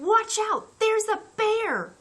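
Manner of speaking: high, loud warning; boy warning his brother of the bear; alarmed shout. Acted. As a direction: yell the warning in fear. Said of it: "he shouted".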